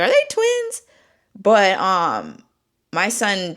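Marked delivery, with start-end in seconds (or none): 0.0-0.8 high-pitched mock question; imitating curious onlookers. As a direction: ask it way up high, like a surprised passer-by. Imitating people's question about babies.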